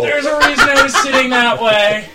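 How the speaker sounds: high, loud, laughing through it; embarrassed laugh while talking. Real, not acted.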